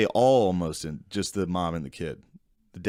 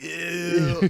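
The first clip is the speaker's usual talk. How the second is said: high squeaky ew; mock disgusted ew; 8 semitones higher than usual. Friend teasing the new dad about cutting the cord, high mock ew. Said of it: "you're like".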